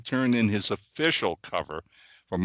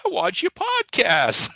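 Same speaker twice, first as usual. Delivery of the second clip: higher, slow, sweet Southern lady voice, lightly done; charming Kentucky accent; 8 semitones higher, 5 dB louder, slower than usual. Retold or imitated. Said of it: "in that charming little accent".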